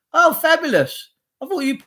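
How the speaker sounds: high, sarcastic oh fabulous. Real, not acted. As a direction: throw it out high with mock delight. Sarcastic reaction to police.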